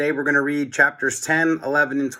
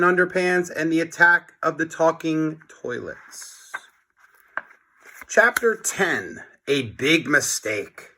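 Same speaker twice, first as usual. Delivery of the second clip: lively storybook read-aloud voice; reading to children; 3 semitones higher than usual. A narrator's reading. Teacher reads chapter aloud to class.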